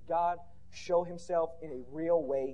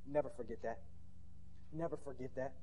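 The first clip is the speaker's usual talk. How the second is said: low, soft, grave repetition; solemn hushed charge; 5 semitones lower, 10 dB quieter than usual. Real, not acted. Preacher urging congregation to remember God, hushed solemn charge. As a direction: drop the voice to make it sink in.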